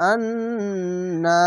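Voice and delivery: আন্না with the নুন held and hummed long, very slow; nasal hold. Shown as a demonstration. Teacher showing the held gunna on আন্না, very slow. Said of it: "এখানে ওয়াজিব গুন্না".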